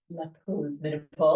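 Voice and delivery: French accent. Shown as a demonstration. Podcast host giving the show's name, French accent. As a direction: put on a French accent for the title. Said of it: "say it in a French accent".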